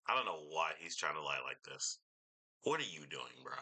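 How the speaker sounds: usual speaking voice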